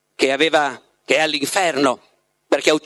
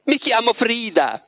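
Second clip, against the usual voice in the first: higher, slowed, imploring; pleading; 6 semitones higher than usual. A narrator's reading. Frida begging Margherita at the ball, slow pleading. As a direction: plead to be remembered.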